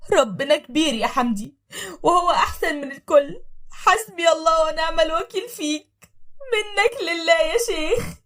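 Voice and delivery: slow, high crying lament; tearful curse. Acted. Wife on the floor cursing her husband through tears. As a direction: sob the curse out.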